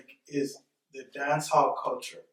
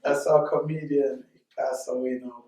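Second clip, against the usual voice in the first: ordinary talk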